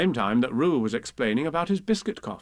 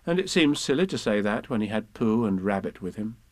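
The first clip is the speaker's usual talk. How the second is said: ordinary delivery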